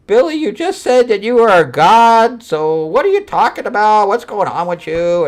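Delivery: high, loud questioning voice; mock exasperation. Retelling her challenging Billy over his claim, mock exasperation.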